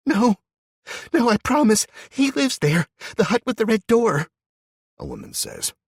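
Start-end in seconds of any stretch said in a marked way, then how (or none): 0.1-4.3 very high, shaky, crying voice; terrified, tearful. A narrator's reading. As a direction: beg for your life in tears. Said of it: "her voice jittery and wet with tears".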